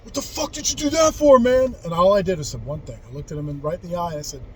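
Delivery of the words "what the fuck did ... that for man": higher, louder angry outburst; angry demand. Retold or imitated. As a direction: retell a friend's furious challenge. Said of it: "He says".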